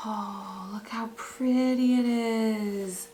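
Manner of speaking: slow, drawn-out whisper of wonder; hushed, lingering admiration; awed whisper. Real, not acted. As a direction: whisper it slowly, overcome by the sight. Awed at the gift fabric.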